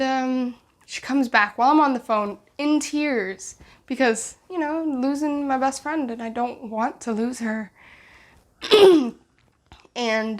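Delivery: usual speaking voice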